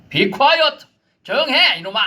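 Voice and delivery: high, loud angry shout; furious rebuke. Acted. Jesus shouting the demon down in anger. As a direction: bark the order at the demon. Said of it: "영어 번역이 조금 더".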